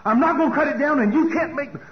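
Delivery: high, loud imitation of a defiant son; defiant shouting. Showing what bondage to self sounds like, defiant shouting. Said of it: "here's what it sounds like".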